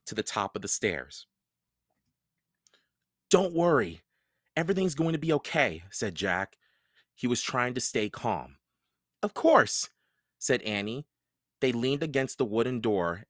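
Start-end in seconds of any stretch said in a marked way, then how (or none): 3.3-5.8 put-on higher boy's voice, fast comforting words; trying hard to stay calm; reassuring boy. A narrator's reading. Jack reassures Annie. 9.2-9.9 put-on higher girl's voice, brave; little girl. A narrator's reading. Annie answers.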